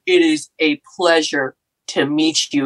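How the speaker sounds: low, slow star athlete; measured, deep, courteous greeting; Chris Carter. Retold or imitated. Football star greeting a crying fan, slow deep voice. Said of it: "Chris Carter".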